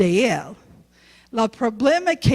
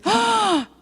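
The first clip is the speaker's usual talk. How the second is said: high sharp gasp of dismay; shocked gasp; 10 semitones higher than usual. Acted. Pastor hearing her youth are at stake, high gasp. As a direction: gasp as if struck.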